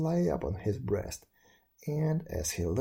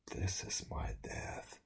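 faint slow whisper, barely voiced; whispered thought; 10 dB quieter than usual. A narrator's reading. Freezing young man whispering to himself as death comes. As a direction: breathe it out as if dying. Dying man's inner thought.

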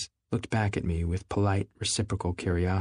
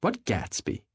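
high sharp demand; demanding question; 8 semitones higher, slower than usual. Acted. Daisy to Nick, high sharp demand. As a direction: insist on knowing which Gatsby. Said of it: "demanded Daisy".

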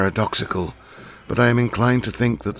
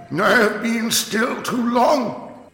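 high, slow, far-carrying prophet's wail; ranting curate; 4 dB louder, slower than usual. Acted. Curate booming at the narrator in the dark, high prophetic wail. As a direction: proclaim it like a half-mad preacher. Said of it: "he said in a tone that must have reached the pit".

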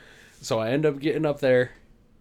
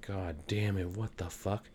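low and much softer, under the breath; muttered cursing; 6 semitones lower, 12 dB quieter, slower than usual. Retold or imitated. Swearing to himself in line, low and soft. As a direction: grumble it to yourself. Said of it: "And I'm like".

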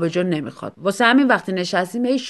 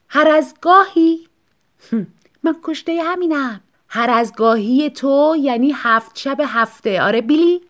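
higher, louder, slow scornful echo; mocking; 6 semitones higher, 5 dB louder than usual. Wife mocking husband's excuse, slow scornful echo. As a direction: throw his words back with scorn.

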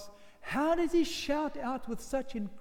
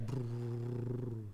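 rumble; 9 semitones lower, 8 dB quieter than usual. Acted. Ground starts to shake.

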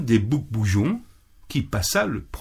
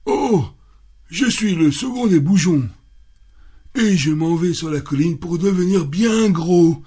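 higher middle goat, a bit sturdier; second goat, less timid than the first; somewhat stronger goat voice; 6 semitones higher than usual. Acted. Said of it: "dont la voix était déjà un peu plus forte".

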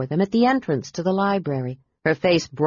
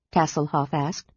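plain reading voice

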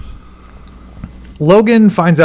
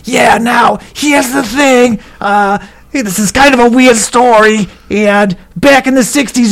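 smoker's rasp; 8 semitones higher than usual. Retold or imitated. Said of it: "this old smoker's voice".

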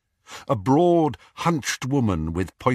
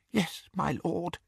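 softer, breathless grateful whisper; 9 semitones higher, 8 dB quieter than usual. Acted. Bellatrix answering her lord, softly whispered. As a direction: murmur it in devotion. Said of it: "whispered Bellatrix".

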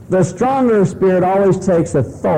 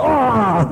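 startled cry; 6 semitones higher than usual. Retold or imitated. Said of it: "and they go".

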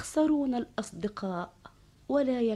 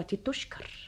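whispering; 7 dB quieter, much slower than usual. Acted. Whispered name in courtly tale.

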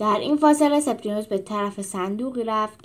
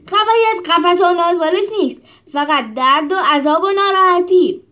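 shout; 7 semitones higher, 9 dB louder than usual. A narrator's reading. Trainer wagging his finger and shouting at the animals, loud. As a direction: shout the rules down at them. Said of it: "داد زد".

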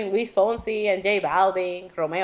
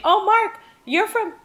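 high, gushing mock interviewer voice; 11 semitones higher than usual. Mocking the interviewer who asked Mark about Canada, high gushing voice. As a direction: imitate a clueless gushing interviewer.

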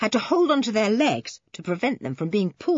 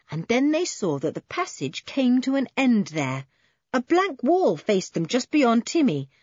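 ordinary delivery

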